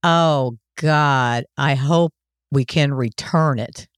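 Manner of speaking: low, slow, dragging imitation of son; mocking quote of son. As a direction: quote him back, low and grudging. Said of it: "You said to me".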